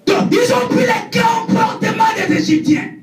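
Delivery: preaching. Acted. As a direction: drive the point home, fervent. (prêche qui remonte avec force)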